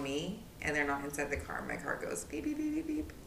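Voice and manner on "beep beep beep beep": high, even string of beeps; imitated car beeping. Telling friends what the car does, high imitated beeping. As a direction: mimic a car's key alert. Imitates car's warning beep.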